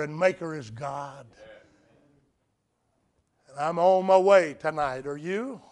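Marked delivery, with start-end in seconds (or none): none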